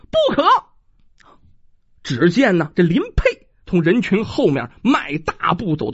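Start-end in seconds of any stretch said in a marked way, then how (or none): 0.1-0.5 high, ringing cry of "stop"; sharp shout. A narrator's reading. Woman stops the villagers from killing, shouting. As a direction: shout them down at once. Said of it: "大喝道".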